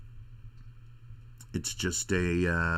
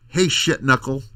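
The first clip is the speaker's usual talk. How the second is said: mock rude greeting; 4 semitones higher, 9 dB louder than usual.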